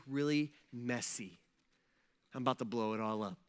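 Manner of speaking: low, soft, understated delivery; lightly acted, matter-of-fact forewarning; quiet warning. Acted God breaking news to Joseph.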